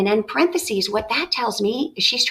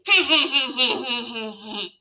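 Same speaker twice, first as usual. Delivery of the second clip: mock insane laugh; 2 semitones higher than usual. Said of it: "He began to laugh insanely".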